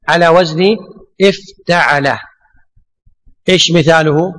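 usual speaking voice

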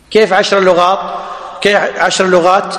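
ordinary talk, nothing marked

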